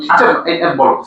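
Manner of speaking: usual speaking voice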